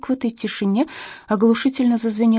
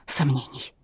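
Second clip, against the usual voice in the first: тихое низкое бормотание себе под нос; пробормотать негромко, с паузой между словами; 3 semitones lower, 6 dB quieter, slower than usual. A narrator's reading. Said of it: "и пробормотал". (тихо бормоча)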